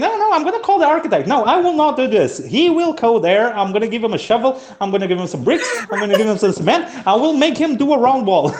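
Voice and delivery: high mock fury, half laughing; high-pitched hammed-up tirade with laughter; retold angry rant. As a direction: play the fed-up engineer vowing revenge. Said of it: "he was saying".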